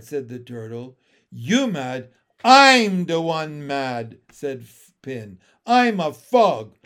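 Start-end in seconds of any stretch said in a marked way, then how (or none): 1.3-6.8 loud, slow frog rant; angry frog. Acted. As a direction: blurt it out furiously. Frog declaring anger.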